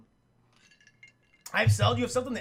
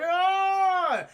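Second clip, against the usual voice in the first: long, loud, high mock cry; pained vampire scream; 11 semitones higher, 8 dB louder than usual. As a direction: howl as the sun burns. Sunlight burns Morbius.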